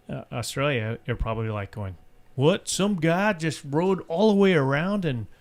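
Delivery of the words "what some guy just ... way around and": loose, bad imitation of an Aussie, higher; lightly put-on Australian twang; a hint of a bad Australian accent; mock Australian. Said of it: "Is that how people sound in Australia?".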